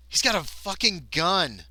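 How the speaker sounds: incredulous, emphatic complaint; shocked outburst. Real, not acted. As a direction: exclaim it in dismay. Shock at enemy's weapons.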